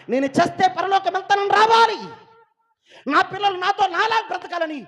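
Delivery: high, very loud pulpit shouting; impassioned preacher at full volume; full-force preaching. Acted. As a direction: build to a shouted cry to God.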